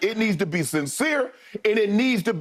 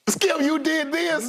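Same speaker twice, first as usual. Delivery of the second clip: high, slow teasing accusation; mock accusation; 9 semitones higher, slower than usual. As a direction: pin it on Skip with a grin. Teasing Skip wrote it.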